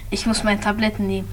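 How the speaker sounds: soft, fast muttered aside; quiet aside. Acted. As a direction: toss it off under the breath. Villain aside after coughing.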